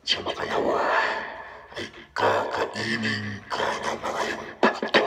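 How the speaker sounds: deep distorted demon voice; demonic layered voice. Shown as a demonstration. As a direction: growl it like a demon through the mic. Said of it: "boses demonyo".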